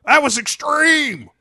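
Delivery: high, loud, stretched surfer bro; hyped extreme-sports bro; dude bro voice. Acted. Aquaman voiced in film recap.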